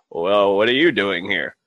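lightly voiced, slow old man. Old guy answering his troll, slow old-man voice. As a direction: turn the question back, drawn out. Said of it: "every time I see an old person".

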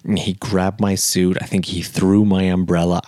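ordinary delivery

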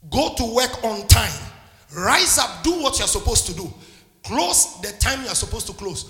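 loud, driving preacher's rebuke; fiery preaching. Acted. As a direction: hammer the point home to the congregation. Preaching at full force.

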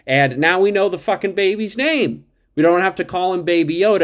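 high, loud fan excitement; excited outburst. Real, not acted. Excited reveal of character's name.

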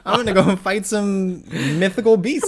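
hammed-up young hero; mock eager kid. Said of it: "this kid's like".

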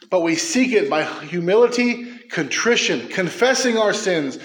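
normal talking voice

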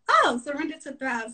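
sudden dawning realization. Retold or imitated. Realization of the mantra.

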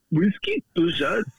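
high mock Indian accent; hammed-up repeated order; imitating Indian passenger. Passenger repeating his order to the attendant, in a high mock Indian accent.

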